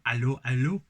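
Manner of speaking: a touch of a hammed-up French accent. Shown as a demonstration. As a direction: do a cartoonish French "Allô, allô". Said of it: "uh, French accent".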